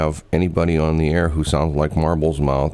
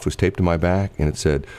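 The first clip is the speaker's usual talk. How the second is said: usual speaking voice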